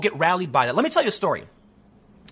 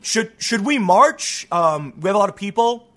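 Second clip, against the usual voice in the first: hesitant rallying attempt. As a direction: imitate a faltering would-be leader asking permission. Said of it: "everyone just mutters and then turns around and ignores him".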